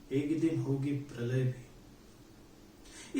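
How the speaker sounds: dragged-out solemn refrain; slow refrain. Acted. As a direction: let the refrain sink, very slowly. Refrain of recited poem.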